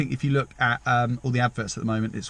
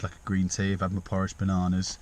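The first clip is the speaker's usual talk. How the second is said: ordinary talk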